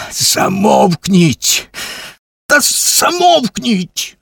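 high, drawn-out cry for silence; winded, high-pitched yell; breathless shout. A narrator's reading. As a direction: cry out high and exasperated, stretching the words. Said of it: "захекано вигукнув Куций".

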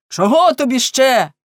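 loud, high, angry demand; furious shout. A narrator's reading. Enraged baker breaks off his complaint at servant, furious shout. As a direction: explode at him with the question. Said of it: "Він так розлютився, що припинив свої скарги і крикнув".

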